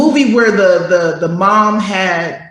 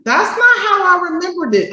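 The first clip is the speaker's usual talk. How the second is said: high, fast protesting voice; insisting on your own memory; imitating doubtful person; 9 semitones higher, faster than usual. Person objecting to rewritten story, high and quick.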